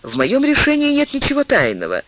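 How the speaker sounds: quick, high, eager answer; lively reply. A narrator's reading. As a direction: answer briskly and with spirit. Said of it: "с живостью ответил Бекингем".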